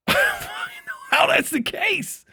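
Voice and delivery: can't believe it, laughing; laughing high disbelief. Real, not acted. Streamer laughing at chat in disbelief, high squealing.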